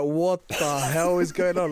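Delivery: slow, drawn-out baffled question; mild mock shock; mock disbelief. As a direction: imitate the friend's shocked reaction. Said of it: "I knew that you would be like".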